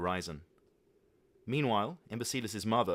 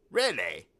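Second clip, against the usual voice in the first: excited; 7 dB louder than usual. Acted. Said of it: "asked Imbecilus excitedly".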